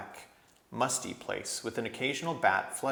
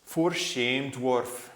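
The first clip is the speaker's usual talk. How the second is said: thick voice; 3 semitones higher, 5 dB louder, much slower than usual. A narrator's reading. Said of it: "came a thick voice".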